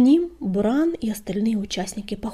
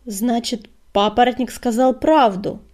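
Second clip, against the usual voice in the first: a raised, barked exclamation; barking; 3 semitones higher, 9 dB louder than usual. A narrator's reading. Deputy reacts to the news, barking. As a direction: bark it out, louder. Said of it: "рявкнул глашатай".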